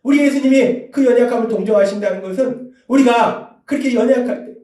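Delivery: ordinary talk, nothing marked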